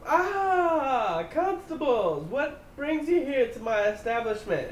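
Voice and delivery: high, bright put-on welcome; sweet innkeeper greeting. Gloria greeting the constables at the door, high and put-on. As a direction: fake cheer to greet the law. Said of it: "she goes".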